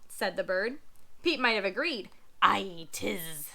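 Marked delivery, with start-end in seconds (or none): 2.4-3.5 low pirate grunt. Acted.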